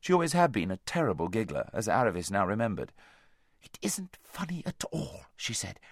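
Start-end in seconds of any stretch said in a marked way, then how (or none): 3.6-5.3 slow, earnest whisper; hushed protest. A narrator's reading. Aravis reproving her giggling friend, hushed protest. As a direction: insist on it in a whisper.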